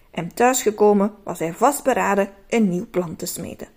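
usual speaking voice

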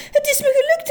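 the cry climbs into a squeal; delighted squeal. A narrator's reading. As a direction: let it burst out in a high squeal. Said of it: "riep meneer Just".

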